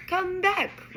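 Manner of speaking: high loud yell after it. A narrator's reading. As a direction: call out after the departing car. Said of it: "Ron yelled after it".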